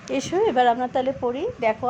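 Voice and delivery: ordinary talk, nothing marked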